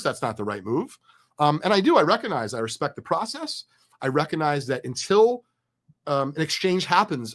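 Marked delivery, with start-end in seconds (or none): none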